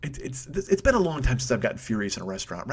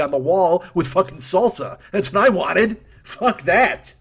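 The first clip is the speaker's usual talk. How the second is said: building to a loud, high-pitched curse; angry rant; 4 semitones higher, 4 dB louder, slower than usual. Real, not acted. Diner raging about what he wanted done, angry rant. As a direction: spit out the revenge fantasy, getting louder. Ranting revenge fantasy against the restaurant.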